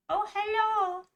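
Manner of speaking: cheery hello. Real, not acted. Greets game character.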